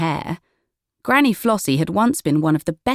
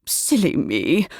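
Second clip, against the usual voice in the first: embarrassed giggle; slower than usual. A narrator's reading. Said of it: "with an embarrassed giggle".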